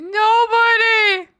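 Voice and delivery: very high, loud, slow mock cry; mock wail. Moaning that nobody joined Pilates, mock wail. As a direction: drag the word out in a fake sob.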